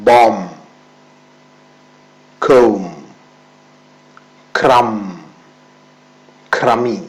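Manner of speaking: ബോം said without the final b; ബോം, കോം, ക്രം, each ending on m with no b; silent b. Shown as a demonstration. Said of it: "ബി silent ആണ് ചില വാക്കുകളിൽ". (ബി ഉച്ചരിക്കാതെ)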